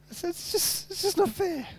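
very high, soft mock-whine; whiny complaint. Preacher mock-complains about kids whose team always wins, whiny voice. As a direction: squeak it out like a sulking kid.

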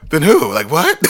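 sudden high, loud what-who; urgent shock. Retold or imitated. Told coworkers bad news, she shouts a loud high demand. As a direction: blurt it out, loud and high, needing to know.

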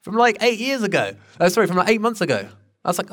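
usual speaking voice

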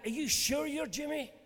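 a hint of a suspicious child; doubtful child.

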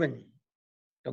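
ordinary talk

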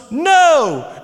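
high, loud echoing shout; imitated yell. Other son joining in, imitated high yell. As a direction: holler it like a copycat kid. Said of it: "And then I yell out".

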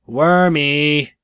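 loud, high call into the cave. Acted. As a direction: shout for the dragon like calling a pet. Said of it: "she called".

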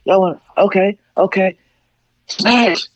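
high mock angry mom, rising; high-pitched mom threatening the kids; mom yelling. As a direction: imitate mom losing it before she smashes. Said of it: "She like".

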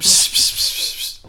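loud, repeated psst; mock-urgent shushing; playful hushing. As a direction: hush them in rapid hissed bursts. Hushing the other's whispered joke.

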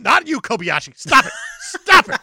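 escalating from loud scold to high shrieked yell; mock outrage. Co-host scolding a hot dog eater, mock-furious. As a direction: build to a screaming fake fit.

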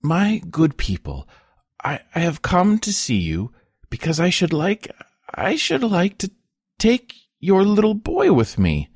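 high, halting voice of a woman pleading; broken, trembling. A narrator's reading. Wife asking peasants for their boy, broken and trembling. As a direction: break it up, high and tremulous, as if barely daring to ask. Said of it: "in a broken, trembling voice".

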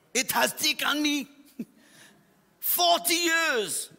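high-pitched mock German accent, drawn out. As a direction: imitate an indignant German-accented intercessor. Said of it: "my slightly German anglicized accent".

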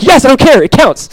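high, loud parent celebrating; acted-out triumphant outburst; excited insistence. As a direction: imitate an overjoyed parent, insisting. Parent insisting the first word counts.

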